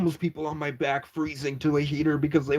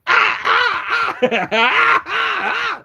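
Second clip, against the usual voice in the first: much higher, louder whooping cackle; loud shouting laugh; 5 dB louder than usual. Real, not acted. Laughing loudly at his own story. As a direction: let out a big yelling laugh. Big burst after punchline.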